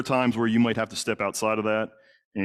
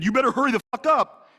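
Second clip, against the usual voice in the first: far higher and louder, full shouted order; retold furious yell; 13 semitones higher, 4 dB louder than usual. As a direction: scream it at him to hurry. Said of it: "Derek, stop yelling at me".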